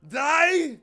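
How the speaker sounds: shouted German teacher impression; loud shout. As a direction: bellow it like a strict teacher. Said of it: "He was so loud".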